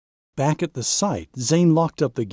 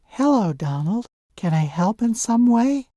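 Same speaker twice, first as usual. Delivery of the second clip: a much higher, bright-eyed helper voice; eager young truck; 9 semitones higher than usual. Acted. Danny offering to help Donald, eager and much higher. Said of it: "Danny comes up to say".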